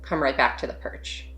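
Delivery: soft, unhurried meditation voice with long pauses; hushed, spacious meditation guidance; guided meditation. Real, not acted. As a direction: gently guide them to settle and notice, leaving space between phrases.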